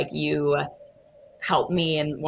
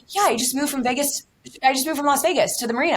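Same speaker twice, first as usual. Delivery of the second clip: much higher, fast chirpy retold fan voice; bubbly fan; 10 semitones higher, 3 dB louder, faster than usual. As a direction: rattle it off like an eager stranger. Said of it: "She's like".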